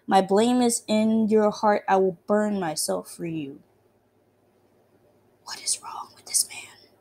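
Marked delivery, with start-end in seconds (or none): 5.4-6.9 hushed unvoiced whisper. Real, not acted. Player whispering about the creepy priest. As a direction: whisper it, unnerved.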